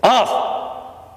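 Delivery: sudden high barked "Off!"; sharp command. Shown as a demonstration. Trainer snapping a command at the puppy. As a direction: snap the command at the dog. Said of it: "So we say, "Chumley, off".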